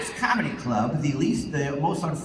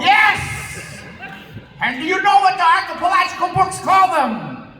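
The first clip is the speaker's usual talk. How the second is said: high, loud excited showman; hammed-up build-up; 12 semitones higher, 5 dB louder than usual. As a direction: shout it like a carnival barker. Mock explorer build-up.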